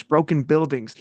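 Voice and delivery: normal talking voice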